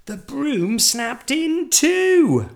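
high, loud, slow climax; loud storyteller's cliffhanger; dramatic narration. A narrator's reading. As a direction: stretch out the disaster dramatically.

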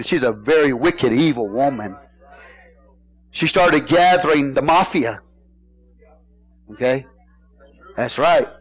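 ordinary delivery

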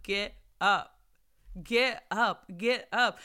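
rally the listener to her feet; urgent command. Real, not acted. Urging listeners to rise.

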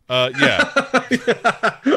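awkward high laughing yeah. Retold or imitated. Dylan introducing himself, awkward laughing yeah. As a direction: laugh through it, embarrassed. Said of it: "Dylan is like".

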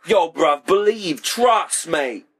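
hammed-up London accent, high and bright; British slang. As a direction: toss off a jokey British street voice. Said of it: "They use words like bruv".

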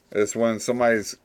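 usual speaking voice

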